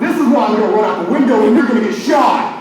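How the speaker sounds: loud, high comic rant; mock outraged retort. Aide snapping back at little Susie over the box, hammed-up angry yell. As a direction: blow up at an ungrateful child.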